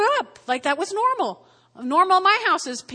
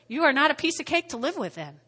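normal talking voice

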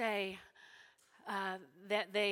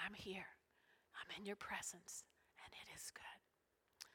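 very soft, unhurried devotional whisper; whispered prayer; 9 dB quieter than usual. Retold or imitated. Speaker tells Jesus she is in his presence, whispered prayer. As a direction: breathe each phrase softly, with long pauses. Demonstrates her private prayer.

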